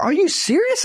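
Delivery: high incredulous question; mock outrage, way up; retold disbelief in the cage. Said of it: "I was in my mind".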